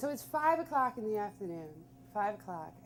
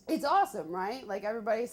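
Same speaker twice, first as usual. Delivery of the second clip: ordinary talk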